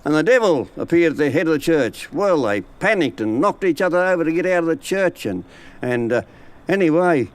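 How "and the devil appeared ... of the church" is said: ordinary delivery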